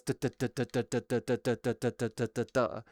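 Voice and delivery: measured mouth gunfire; slow firing duhs. Shown as a demonstration. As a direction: mimic slow normal fire rate. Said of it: "normally it's like".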